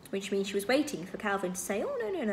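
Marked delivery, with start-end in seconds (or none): 1.8-2.3 high swooping denial; reassuring protest. A narrator's reading. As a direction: contradict kindly in a high voice. Imagined contradiction, reassuring protest.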